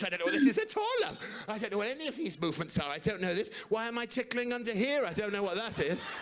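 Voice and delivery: high, hammed-up bewilderment; baffled mum. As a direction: imitate a mum innocently puzzling it out. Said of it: "So my mum was doing this".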